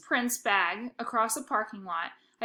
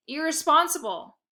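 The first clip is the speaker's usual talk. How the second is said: yelling; 6 semitones higher, 5 dB louder than usual. Retold or imitated. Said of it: "She yelled at me".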